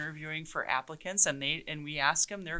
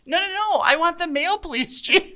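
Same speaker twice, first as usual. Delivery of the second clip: much higher, louder, hammed-up stubborn old man; exaggerated old man; 13 semitones higher, 8 dB louder than usual. Chief mocking the resident's demand, hammed-up and high. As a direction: ham up the grumpy resident, laughing. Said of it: "the guy looks at me and he goes".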